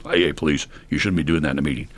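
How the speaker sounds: low, fast officious mutter; mock authority in a dropped voice; self-appointed old-timer police. Old timer policing someone in a meeting, officious scolding. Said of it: "some old timers think they are".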